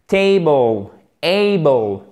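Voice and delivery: TABLE, ABLE, each with a long "AY", falling; long diphthong. Shown as a demonstration. Teacher drilling the diphthong from state, long and open. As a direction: stretch the first vowel in Table and able. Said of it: "la même diphtongue que dans state".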